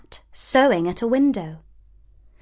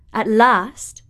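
ordinary delivery, nothing marked

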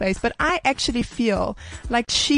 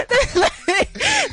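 laughing. Real, not acted.

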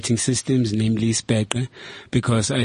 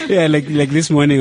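laughing; 5 semitones higher, 6 dB louder than usual. Real, not acted. Still laughing.

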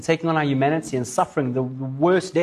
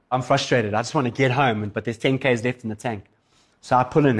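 usual speaking voice

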